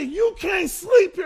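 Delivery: high, slow, loud hostile shout. Retold or imitated. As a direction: let the old temper explode at him. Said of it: "I got hostile".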